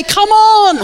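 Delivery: high, rousing shout; re-enacted battle cry to oneself; David's shout. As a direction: yell it to spur yourself on. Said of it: "David shouted to himself every day".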